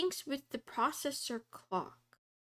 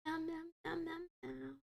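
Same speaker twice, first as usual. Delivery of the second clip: softer, playful kitty meows; soft cat meows; 5 semitones higher, 9 dB quieter, faster than usual. Pretend. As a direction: meow like a little cat. Greeting a kitty in chat.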